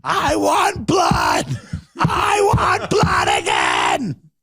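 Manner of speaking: hammed-up bloodthirsty rally scream. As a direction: scream it like a frenzied candidate. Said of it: "He just starts screaming".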